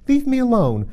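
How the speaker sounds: high, loud indignant demand. A narrator's reading. Alice tells the pigeon to back off, indignantly. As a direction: push the accuser away with your voice. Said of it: "said Alice indignantly".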